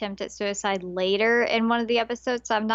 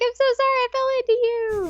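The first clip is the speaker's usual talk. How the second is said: put-on much higher, quick flustered girl voice; imitating the girl, embarrassed; flustered apology; 14 semitones higher, 5 dB louder, faster than usual. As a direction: blurt the apology in a tizzy. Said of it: "she's like".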